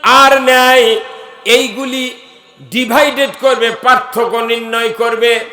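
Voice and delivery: loud, emphatic sermon delivery, last word drawn out long; full-force preaching. Acted. Preacher warning the crowd about usury, full-force preaching. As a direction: preach it loudly, ending in a sustained chant.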